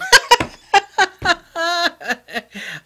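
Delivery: loud, high burst of real laughter; a genuine burst of laughter. Real, not acted. Laughing about kilt idea.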